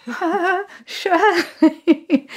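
tiny, very high embarrassed voice; mock terrified caller giving a name; nervous squeak. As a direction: squeak it out, half laughing. Nervous reply to crush's parent.

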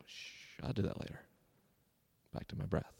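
unvoiced whisper to oneself; hushed self-soothing. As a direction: shush the thought and settle back, barely audible. Hushing own thoughts while meditating.

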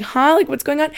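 high, baffled double take; confused. Retold or imitated. Bag owner reacting to strangers yelling at her, acted confusion. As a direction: act out a startled, puzzled "huh". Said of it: "I'm so confused".

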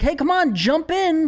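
high-pitched mock excited call; hammed-up beckoning. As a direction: egg someone on, high and playful. Imitates call to jump in.

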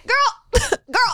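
put-on high laughing "girl"; mock commenter. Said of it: "They would be like".

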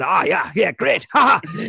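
Geordie lilt, light and chirpy, ending in a laugh; a hint of Ant's Geordie cheer. Imitating Ant from Ant and Dec in Geordie. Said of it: "you got Ant going".

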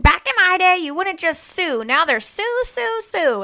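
high, loud opening in a mock rant; hammed-up imitation of dad complaining; griping dad. Dad complaining about lawsuits these days, hammed-up griping. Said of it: "bitching about how everybody".

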